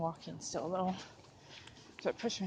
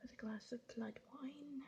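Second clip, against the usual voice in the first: spooky vampire whisper; 4 semitones higher, 10 dB quieter than usual. Pretend. Spooky joke before cranberry reveal.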